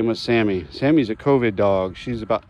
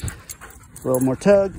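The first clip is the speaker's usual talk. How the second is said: coaxing; 8 semitones higher, slower than usual. Real, not acted. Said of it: "get her excited".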